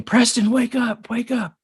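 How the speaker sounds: high, drawn-out call to rouse someone; urgent calling. Retold or imitated. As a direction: imitate a cousin urgently waking someone. Cousin trying to rouse him.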